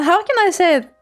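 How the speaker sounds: ordinary talk, nothing marked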